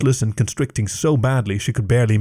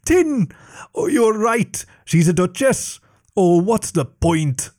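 high, slowing despairing wail; a dragon giving up, wailing; 8 semitones higher, slower than usual. Acted. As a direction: cry out in dramatic despair.

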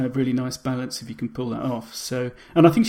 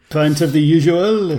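slow, loud mock-French drunk; drunken Frenchman; 3 semitones higher, 8 dB louder, slower than usual. Podcaster ordering at the bar, drunken French accent. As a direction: drag it out in a hammed-up French accent. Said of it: "drunken Frenchman".

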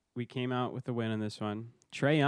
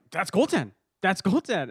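high, louder indignant exclamation; mock an announcer protesting the call; mad announcers; 7 semitones higher, 5 dB louder than usual. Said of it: "Even the announcers were just, like, so mad".

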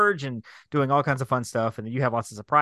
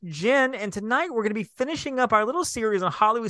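higher announcer-style host voice; podcast host intro; 7 semitones higher than usual. Acted. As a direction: announce the show.

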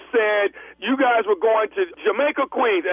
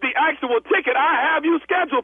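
louder, higher, heated comeback; yelling back; 6 semitones higher, 3 dB louder than usual. Acted. Agent yelling back at the angry bride, heated. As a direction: shout her down in frustration. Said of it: "stop yelling at me".

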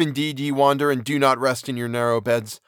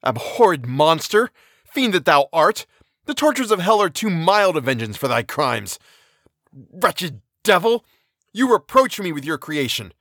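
raging outburst; 5 semitones higher than usual. Acted. Victor screams defiance at his creature, raging shout. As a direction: spit curses at the monster. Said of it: "My rage was without bounds".

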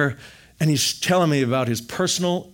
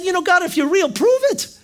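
much higher, pleading, voice cracking; high tearful challenge to God; strained plea; 4 dB louder than usual. Retold or imitated. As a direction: cry out to God, desperate.

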